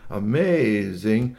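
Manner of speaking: "amazing" stretched out long; big, slow emphasis on "amazing"; hyped stress on amazing. A narrator's reading. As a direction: draw out "amazing" like a salesman. Hyping the mower like an ad.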